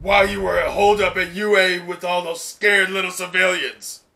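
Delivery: a hint of a sneering villain; mocking villain. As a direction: sneer at the younger brother. Voicing the villain Dabi.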